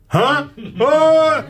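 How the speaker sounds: high, loud gasp of disbelief; very high yelp of amazement; shocked squeal. Retold or imitated. Acted shock at interview news.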